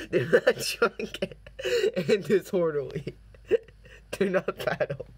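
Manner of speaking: reading signs while cracking up, words breaking into laughter; trying to read but can't stop laughing; laughing through it. Real, not acted. Reading silly signs to viewers, laughing helplessly. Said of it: "I can't say any of this without laughing".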